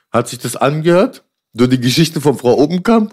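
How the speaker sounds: ordinary delivery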